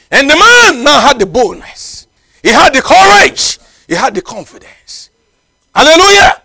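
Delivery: high, loud, rising with triumph; triumphant preaching. Acted. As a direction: lift the church with the man's new courage. Triumphant climax of the story.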